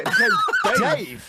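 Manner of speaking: can't believe the nickname; surprised disbelief. Real, not acted.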